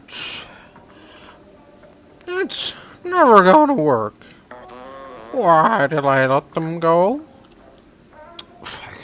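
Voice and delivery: put-on high, strained old man. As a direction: croak it like an old robot man. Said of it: "old man voice".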